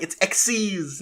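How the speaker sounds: "Xyz" said as one word, like ex-seez; read as a word. Retold or imitated. Host quoting the other schoolkids' version, "Xyz" pronounced ex-seez. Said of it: "the other ones are like".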